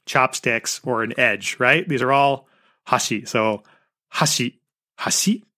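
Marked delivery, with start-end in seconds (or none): 4.1-4.6 "hashi" starting high, dropping on the second syllable; high-low pitch accent. Shown as a demonstration. Showing three lookalike Japanese words, high-low pitch on "hashi". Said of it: "if it's high, low". 5.0-5.4 "hashi" jumping up high on the second syllable; low-high pitch accent. Shown as a demonstration. Showing Japanese pitch accent to listeners, low-high "hashi". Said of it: "low, high".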